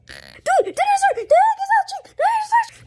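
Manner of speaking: high, loud, excited yells; excited shouting. Acted. The dinosaur has just hatched.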